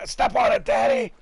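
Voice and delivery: high, loud mock kid yell; imitating excited son. Son urging Daddy to step on it, mock child's yell. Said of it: "he's like".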